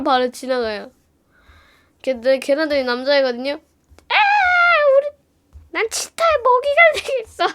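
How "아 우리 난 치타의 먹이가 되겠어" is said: high put-on panic voice; hammed-up terrified boy; mock dramatic boy. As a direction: squeal it like you're about to be eaten. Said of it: "하면서 막".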